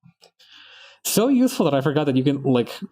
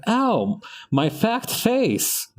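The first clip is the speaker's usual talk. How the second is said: put-on higher, slow little-kid whine over a scraped face; young kid after a fall; 11 semitones higher, much slower than usual. Kid who tripped on the playground, higher slow hurt whine. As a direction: play the small boy who tripped and milks it. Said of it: "I was like".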